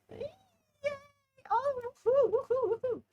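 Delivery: very high, loud woohoo cheer; excited cheer. Real, not acted. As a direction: cheer it out giddily.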